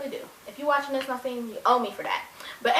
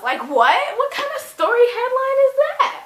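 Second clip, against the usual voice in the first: incredulous; 12 semitones higher, 5 dB louder than usual. Real, not acted. Incredulous at imagined headline.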